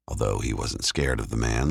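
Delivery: ordinary delivery, nothing marked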